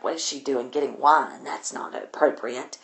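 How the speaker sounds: low, soft disapproving aside; mock disapproval. Blanche eyeing her getting wine, quietly disapproving. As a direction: voice a snooty onlooker's thought under your breath.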